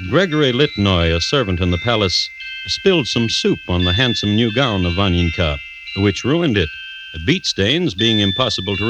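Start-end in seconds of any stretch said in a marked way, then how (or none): none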